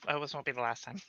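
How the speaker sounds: bashful murmur to the charmer; flustered, trailing off. Acted. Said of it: "Completely flustered now".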